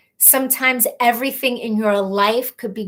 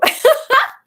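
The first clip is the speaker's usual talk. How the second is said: sudden high burst of laughter; 6 dB louder than usual. Real, not acted. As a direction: laugh out loud at the joke. Laughs at the nickname.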